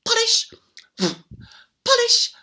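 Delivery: ordinary delivery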